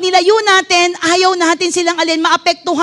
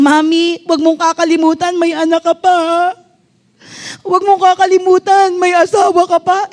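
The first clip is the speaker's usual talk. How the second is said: child's words, lightly voiced. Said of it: "Sabi niya, kaya nga yung mga katagang".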